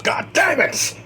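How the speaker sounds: high-pitched furious shout; anguished yell. A narrator's reading. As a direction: cry out in rage and grief. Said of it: "he yells".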